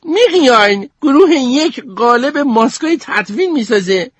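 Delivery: put-on very high, exasperated girl voice; annoyed. Goldie complains angrily about the group's project, very high. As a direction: spit out the complaint, fed up. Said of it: "با حرص گفت".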